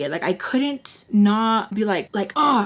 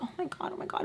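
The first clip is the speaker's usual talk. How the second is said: hushed, breathy swooning; soft gushy whisper, mocking; mock fawning; 7 semitones higher, 13 dB quieter, much faster than usual. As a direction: imitate whispering admirers. Everybody fawning over him.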